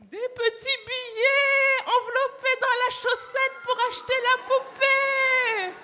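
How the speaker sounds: high, slow and loud in wonder; awed reveal. A narrator's reading. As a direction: unveil the treasure slowly. Revealing the hidden money.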